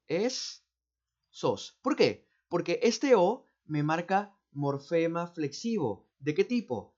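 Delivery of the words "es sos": esos split into es and sos with a pause between; segmented. Shown as a demonstration. Teacher showing students how esos is segmented, split in two. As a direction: break esos into es, then sos. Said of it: "Así, mira".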